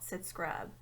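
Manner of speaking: plain reading voice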